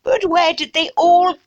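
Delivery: hammed-up upper-class English accent; snotty English. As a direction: stretch the vowels absurdly in a snooty English accent. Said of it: "I think snotty English".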